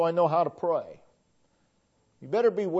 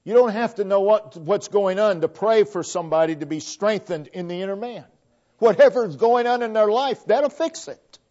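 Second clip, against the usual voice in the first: louder, higher fiery preaching; preaching at full force; 6 semitones higher, 8 dB louder than usual. Acted. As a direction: lift the congregation with rising fervor. Preaching builds to a climax.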